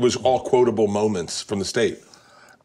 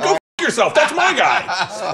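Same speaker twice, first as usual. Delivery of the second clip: high, laughing, slow that's my guy; mock defiant fan; 11 semitones higher, 3 dB louder, slower than usual. To the crowd, laughing, a fan's defiant boast.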